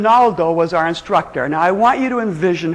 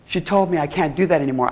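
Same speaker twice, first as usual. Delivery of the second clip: ordinary talk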